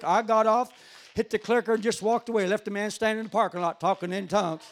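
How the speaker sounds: loud, high-pitched testimony preaching; full-force preaching. Real, not acted. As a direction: belt out the story to the congregation.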